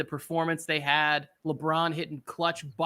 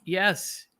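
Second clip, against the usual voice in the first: usual speaking voice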